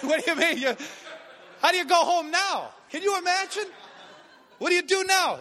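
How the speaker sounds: loud, high-pitched comic outrage; incredulous dismay. Retold or imitated. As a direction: protest in hammed-up disbelief. Acts out dismay at rejection.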